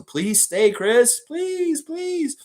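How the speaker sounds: high, pleading whine that climbs; playful begging. Pretend. Fan begging Chris to stay on the team, hammed-up plea. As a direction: beg in an exaggerated high voice.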